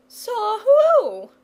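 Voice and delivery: high, loud, alarmed question; nervous terror. A narrator's reading. Wife to shepherd, catching his nervous terror. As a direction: cry it out as fear catches on. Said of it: "beginning to share in her husband's nervous terror".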